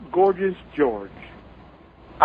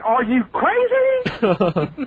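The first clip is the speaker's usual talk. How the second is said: incredulous; 6 semitones higher, much slower than usual. Retold or imitated. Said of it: "I can remember saying".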